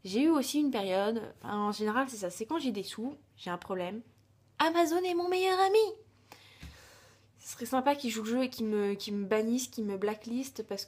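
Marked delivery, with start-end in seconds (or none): 4.6-5.9 high, loud mock love for Amazon; playful over-the-top confession; gushing declaration. Real, not acted. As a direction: gush over it like a best friend. Jokey confession about online shopping.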